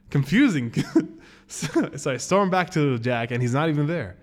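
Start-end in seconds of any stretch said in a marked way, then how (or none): none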